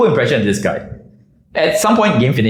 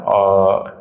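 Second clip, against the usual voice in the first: timid, hesitant mumble, slow; a low-confidence guy mumbling an excuse; meek guy's voice; 4 semitones lower, much slower than usual. Retold or imitated. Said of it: "he goes like".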